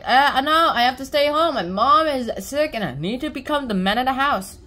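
acted boyish protest at full volume; calling back. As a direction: holler back an explanation, easing off toward the end. Sullivan shouting back to kids.

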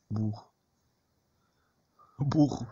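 ordinary delivery

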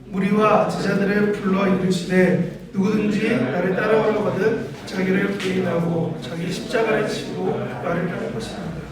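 느리고 고르게 함께 읽는 낭독; 회중과 박자를 맞춰 또박또박 낭독. Real, not acted. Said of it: "전사님과 함께 읽겠습니다". (함께 읽는 차분한 성경 낭독)

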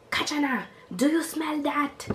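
high-pitched nagging mom impression; imitating mom. Mom confronts daughter about a smell, imitated high voice. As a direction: question it high, like a suspicious mother.

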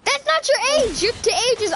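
high-pitched, incredulous objection; baffled, scolding comeback; exasperated correction. Real, not acted. Exasperated by a non-answer.

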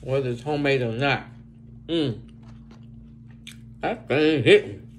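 chewing while talking; eating fries between words; mouth full. Real, not acted. Seen 0: eats flatbread, then fries in mouth while talking.

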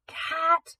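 a high call from far away; distant call. Acted. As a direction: call out from down the shaft. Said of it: "Gabrielle's voice echoed in the distance".

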